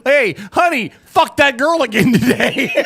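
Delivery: lightly voiced cheating husband; mock cheating husband. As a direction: blurt it like a sheepish husband. Mock husband voice, louder, laughing.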